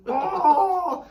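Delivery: high, loud mock howl of pain. Friend acting out chilli burn in toilet, pained howl. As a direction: howl as if suddenly burning.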